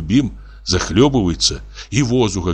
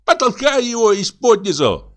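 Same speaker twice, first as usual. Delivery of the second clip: very high, squeaky peasant voice; squealing tenor. A narrator's reading. Fisherman urging his mate to pull the fish, squealing. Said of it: "слышит он голос Любима".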